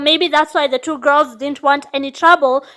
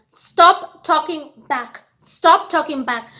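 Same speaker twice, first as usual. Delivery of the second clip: slow insistent warning; slower than usual. Retold or imitated. Waitress insists firmly.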